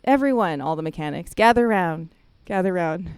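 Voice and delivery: loud call to gather. Retold or imitated. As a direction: summon the whole shop. Coworker calling the whole shop.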